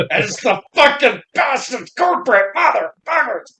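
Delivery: high, strangled angry-comic rant; mock apoplectic fury, no extra volume. Friend mimicking a comedian near a stroke, mock-furious rant. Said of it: "another comedian that has some anger issues".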